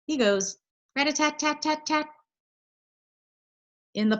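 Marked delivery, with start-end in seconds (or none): 0.1-2.1 high, rapid tapping; woodpecker rat-a-tat. Retold or imitated. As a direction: tap it out like a woodpecker. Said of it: "sounds like a little hammer".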